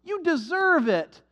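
high, sugary mock praise; mocking flattery. Preacher mocking the world's flattery, high sugary voice. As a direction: coo it like a fawning salesman. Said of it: "that's what they want to tell you".

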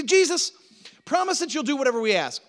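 high, loud, wheedling plea; high loud mock pleading; pleading disciples. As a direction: beg like eager brothers wanting a favour.

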